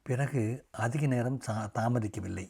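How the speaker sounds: ordinary delivery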